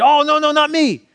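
high-pitched, hammed-up denial; acted protest. Peter protests Jesus's prediction of denial.

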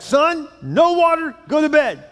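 loud, high, slow shout; dad yelling. Acted. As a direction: put your foot down from the couch. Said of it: "He yells back".

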